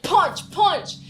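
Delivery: loud, high punch, punch; mock punching sounds. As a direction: act out the blows with your voice. Mimicking the fist fight.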